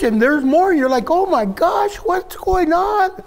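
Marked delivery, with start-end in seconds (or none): 1.1-3.2 exasperated outburst. Retold or imitated. Overwhelmed person quoted.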